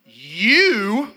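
"You" punched loud and accusing; mock-angry "You" from a daughter; stressed pronoun. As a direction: hit "You" hard like a charge. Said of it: "start stressing pronouns".

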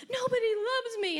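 put-on high whiny child cry; lightly mocked tantrum; mock wail. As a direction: imitate a wailing toddler.

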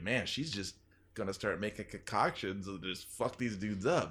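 muttered aside. Retold or imitated. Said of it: "I was like".

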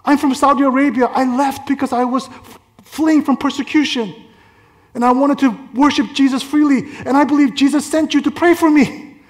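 high-pitched imitation of an eager woman; excited believer woman. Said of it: "And she goes".

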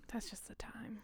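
very soft resigned whisper. Real, not acted. Friend whispering a resigned shrug. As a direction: breathe it out, resigned.

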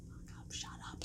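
acted whisper between hiders; hushing whisper. Hidden friend hushing the other, imitated whisper. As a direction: whisper a sharp order for silence.

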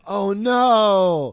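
high, loud stunned oh no; the moment it all hits you; dawning horror. Retold or imitated. Realizing he's the bad roommate, a loud stunned gasp.

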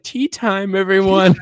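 high, sing-song tea party voice; high pretend voice. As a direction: play a child hosting tea. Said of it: "He's like".

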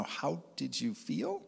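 soft, slow, careful question; hesitant asking, drawn out and rising at the end; reluctant question. Retold or imitated. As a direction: ease into an awkward question, slowly. Reluctantly asks the awkward question.

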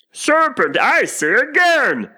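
high, loud, drawn-out accusation; slow, emphatic pigeon screech. A narrator's reading. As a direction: insist shrilly like an angry bird. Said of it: "repeated the pigeon, but in a more subdued tone".

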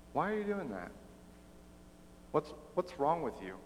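soft, puzzled question; confused, almost to yourself; puzzled soldier, quietly; baffled soldier. Retold or imitated. Soldier baffled by extra mile.

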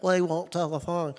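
lightly put-on Australian accent; amateur imitation Australian accent; mock Australian accent. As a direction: imitate an Aussie with garbled gibberish. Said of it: "Australian accent".

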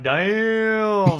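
a high, loud, drawn-out damn; stunned damn. Retold or imitated. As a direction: let the damn hang in shock. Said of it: "And I was like".

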